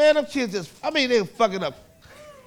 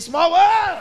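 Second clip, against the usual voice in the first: put-on high, loud excited kid shout; kids hollering; 7 semitones higher, 4 dB louder than usual. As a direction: holler it out like an unruly kid, stretching the last word. Kids hollering while hitting someone.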